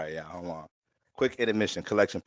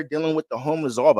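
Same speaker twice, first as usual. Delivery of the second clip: usual speaking voice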